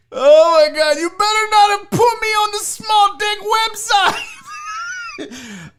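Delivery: real high-pitched shouted protest; alarmed yell. Real, not acted. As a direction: yell it in rising panic and disbelief. Realises he may be on website.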